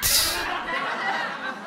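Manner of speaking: breathy high cackle; real high laugh. Real, not acted. Big laugh.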